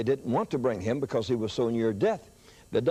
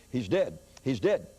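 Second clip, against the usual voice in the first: ordinary talk, nothing marked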